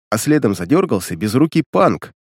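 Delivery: ordinary delivery, nothing marked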